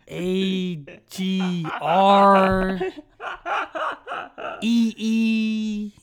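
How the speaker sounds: unsure classmate. Retold or imitated. Mocking the unsure classmate spelling the word, very slow and hesitant. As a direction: drag each letter like you doubt it. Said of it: "unsure of himself, unconfident as fuck".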